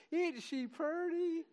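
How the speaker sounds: high mock gush over the baby; gushing new parent. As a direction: coo it like a doting relative over a newborn. Imitating a doting new parent.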